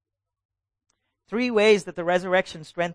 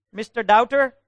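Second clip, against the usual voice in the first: ordinary talk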